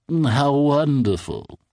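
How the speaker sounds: creepy odd man. A narrator's reading. Odd man asking the cartoonist to place an ad, creepy and smug. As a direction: speak with a fixed unsettling smile.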